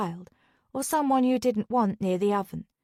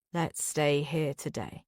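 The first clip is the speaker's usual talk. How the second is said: low, slow sleepy murmur; half-asleep, words dragging; groggy murmur; 7 semitones lower, 5 dB quieter, slower than usual. A narrator's reading. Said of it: "Jack murmurs groggily".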